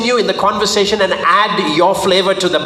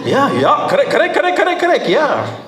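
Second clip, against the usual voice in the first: eager agreement; 10 semitones higher than usual. Retold or imitated.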